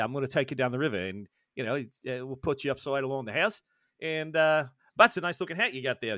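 improvised Australian river guide; Australian accent. Shown as a demonstration. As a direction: demonstrate a rough, homemade Australian accent. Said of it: "what does it sound like".

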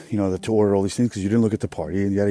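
normal talking voice

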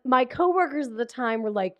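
normal talking voice

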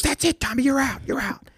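high, exasperated mock benching; dad coach angrily benching his son; fed-up dad. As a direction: pull him off in disgust, pitched high. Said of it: "And he goes".

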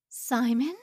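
loud, rising astonished question; can't believe her eyes; stunned disbelief. A narrator's reading. Mrs Stern stunned to see him.

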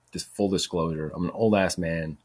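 ordinary delivery

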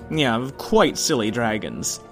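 plain reading voice

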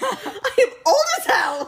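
put-on high, slow, laughing parody of a lovesick old warrior; mock old Cassian. To friends, parodying Cassian's confession to Nesta, high and laughing. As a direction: ham up the ancient Fae confessing. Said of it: "mocking Cassian's confession".

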